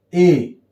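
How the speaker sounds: ए read alone as a full "ay"; strong form. Shown as a demonstration. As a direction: say ए as the letter name "ay". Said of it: "यहां इसको हम पढ़ेंगे". (ए, अकेले पूरे स्वर में)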